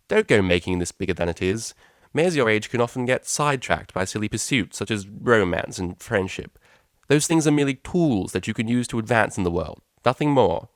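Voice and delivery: cold, imperious and dismissive; mother cutting short the pleasantries; stern mother. Acted.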